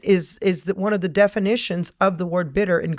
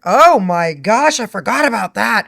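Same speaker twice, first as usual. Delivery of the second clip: sudden realization; 2 semitones higher, 8 dB louder than usual. Retold or imitated. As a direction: exclaim as a forgotten memory hits you. Acts sudden realization while writing.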